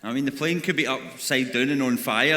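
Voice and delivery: ordinary talk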